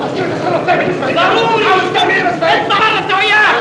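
high, loud shouts in a heated row; furious high-pitched outburst; angry shouting. Acted. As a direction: yell at them to get out, pitched high. Angry row, ordering people out.

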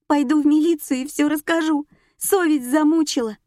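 high-pitched, desperate wail; hysterical sobbing. A narrator's reading. Morgunova threatens to confess to police, sobbing hysterically. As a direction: wail the threat through despair. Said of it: "рыдала Моргунова".

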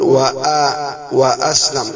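وآ stretched very slowly, then وأسلم said with the hamza clearly sounded; teacher slowly sounding out وأسلم so the hamza is heard; drawn-out demo. Shown as a demonstration. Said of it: "واو زائد أسلم، فتقول".

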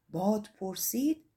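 plain reading voice

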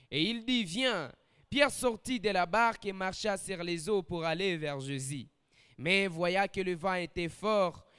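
measured, solemn Bible reading with pauses; steady, reverent reading of the passage; scripture reading. Acted. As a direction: read the gospel aloud to the congregation. Reads Matthew 14 aloud.

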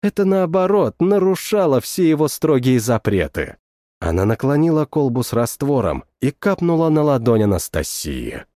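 plain reading voice

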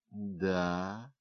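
soft, composed confirmation; measured, level, unhurried reply; even voice. A narrator's reading. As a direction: confirm it calmly, without agitation. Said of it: "ответила она тем же ровным голосом".

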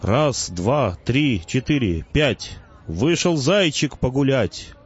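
slow, measured nursery-rhyme incantation; theatrical declaiming. A narrator's reading. Narrator fakes a resurrection spell, declaiming theatrically. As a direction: declaim it grandly, beat by beat. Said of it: "картинно взмахнул руками и продекламировал".

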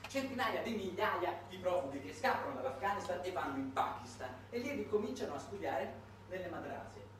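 ordinary delivery

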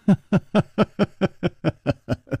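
easy, high amused laugh. Acted. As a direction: chuckle like a relaxed host. 楚留香笑着说. (楚留香笑迎众人，爽朗轻笑)

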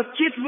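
كتف with kasra on kaf, sukun on ta; moved vowel. Shown as a demonstration. Teacher showing the moved vowel, "kitf". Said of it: "بنقل حركة العين".

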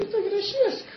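very high, light admission; a mock-innocent high confession. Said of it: "Și eu zic".

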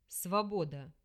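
low breathy demon sigh; breathed out. Acted. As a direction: exhale the word deeply. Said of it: "выдохнул он".

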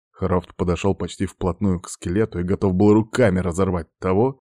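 ordinary delivery

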